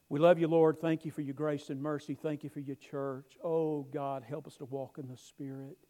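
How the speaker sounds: opens loud, then a hushed earnest prayer. Acted. Closing prayer.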